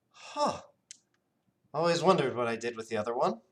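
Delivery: fast, offhand genie musing; breezy, scatterbrained aside; absent-minded genie. Acted. Genie noticing the matching bangle, fast and offhand.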